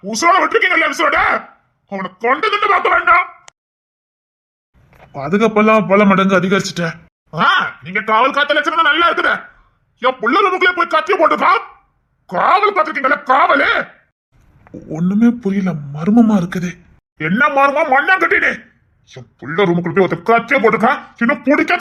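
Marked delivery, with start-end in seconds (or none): 0.1-1.2 sarcastic, raised pitch; angry scolding. Acted. Boss scolds guards after knife attack. 7.3-13.7 higher, biting scolding; mock the useless guards; sarcastic anger. Boss mocks guards' failure. 17.2-18.5 higher, mocking retort; scornful. Boss mocks "mystery" excuse.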